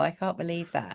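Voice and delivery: ordinary talk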